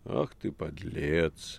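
very slow, musing reproach; thoughtful. A narrator's reading. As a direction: draw it out pensively. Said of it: "задумчиво сказал Воланд".

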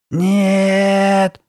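long, high, loud scream. A narrator's reading. Girl rejecting the new home idea, long scream. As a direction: cry it out like a wounded animal. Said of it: "закричала Карли Бет".

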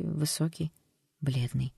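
usual speaking voice

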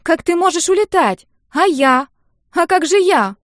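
put-on very high, loud girl's protest; shrill-pitched indignant questions; outraged wail. A narrator's reading. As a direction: wail as if being abandoned. Sister protests at father leaving.

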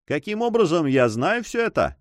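wry, teasing comeback; mocking interruption. A narrator's reading. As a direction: cut in with a mild sneer. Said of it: "с усмешкой перебил Алане".

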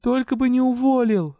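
high, loud desperate plea; pleading cry. A narrator's reading. Heroine begs into nowhere, a high loud desperate plea. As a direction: beg the heavens loudly. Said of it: "возмолилась я".

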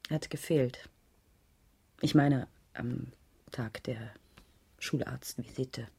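soft, hushed admission; whisper. Acted. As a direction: barely whisper it, reluctant. Lehrerin gesteht dem Inspektor. (leise geflüstertes Eingeständnis)

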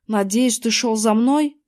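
ordinary delivery, nothing marked

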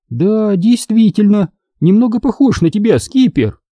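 ordinary delivery

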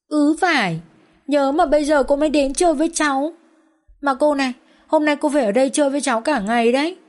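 put-on high, eager child's voice; young daughter pestering her father and Vân, high childish voice; chatty little girl. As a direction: play a pushy, adoring little girl. Child character's voice.

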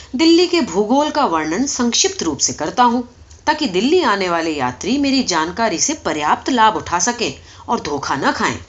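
plain reading voice

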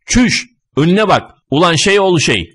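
high-pitched cursing cry; angry cursing. A narrator's reading. Jostled passers-by curse the running man, angrily. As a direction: swear at someone who barged into you. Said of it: "Küfürün bini bir para".

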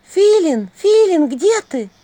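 high, loud call down a corridor. Acted. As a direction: call for someone far away. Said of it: "послышался голос Василисы в коридоре".

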